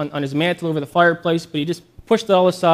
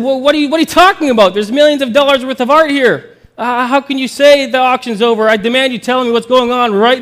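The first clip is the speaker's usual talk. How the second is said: loud, high, fast outraged protest; irate bidder; 7 semitones higher, 6 dB louder than usual. Acted. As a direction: demand an answer, furious.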